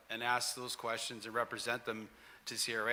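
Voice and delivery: ordinary delivery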